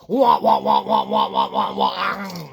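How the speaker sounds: imitating the Pac-Man game-over tune, falling in pitch; Pac-Man wah-wah. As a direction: imitate the Pac-Man death sound, sliding down.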